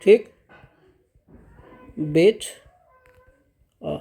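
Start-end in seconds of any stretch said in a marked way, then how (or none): 2.0-4.0 "बेट", a long pause, then "अ" alone; syllable break. Shown as a demonstration. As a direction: pull "बेट अ" apart into two separate syllables. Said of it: "इस तरह से इसका हम लोग syllable तोड़ देंगे". ("बेट" और "अ" के बीच लंबा विराम)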